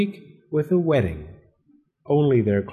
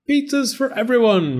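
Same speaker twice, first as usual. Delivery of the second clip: high, loud holler; 12 semitones higher, 4 dB louder than usual. A narrator's reading. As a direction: call it out to the whole beach.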